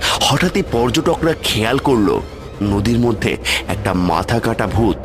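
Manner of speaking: ordinary delivery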